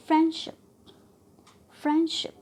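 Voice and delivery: Friendship with FRIEND high and loud; first noun stressed. Shown as a demonstration. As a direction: punch 'friend', leave 'शिप' weak in friendship. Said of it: "friends को trust किया". (Friendship में 'friend' पर ज़ोर)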